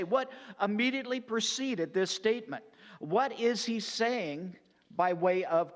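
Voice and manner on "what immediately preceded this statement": ordinary talk, nothing marked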